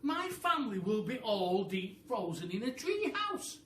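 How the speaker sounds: high, loud, worried monkey cry; a monkey crying out in alarm. Acted. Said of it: "cried Mugglewump".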